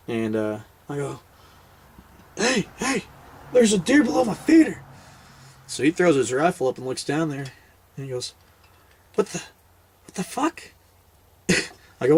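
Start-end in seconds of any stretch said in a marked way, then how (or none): 2.4-4.8 much higher-pitched mock alarm; excitedly tip off a hunting buddy, imitating the moment; acted alert. Hunter alerting buddy to deer below feeder, acted excitement. 9.1-10.6 mock bafflement. Buddy looking down at the feeder, acted bafflement. Buddy's retold startled reaction.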